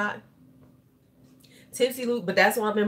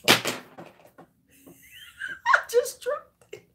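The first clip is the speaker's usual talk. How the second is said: squeak of dismay. Real, not acted. Dropped the lipstick.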